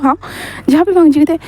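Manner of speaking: ordinary delivery, nothing marked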